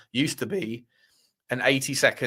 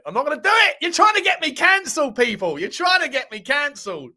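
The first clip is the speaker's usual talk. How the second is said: high, loud protest played for laughs; hammed-up high-pitched refusal; mock outrage; 12 semitones higher, 4 dB louder than usual. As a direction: protest in exaggerated indignation, pitch shooting up. Mock outrage at chat egging him on.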